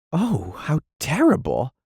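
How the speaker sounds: genuinely shaken sympathy; high, pitying cry; sincerely moved. A narrator's reading. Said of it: "Muriel was sincerely moved".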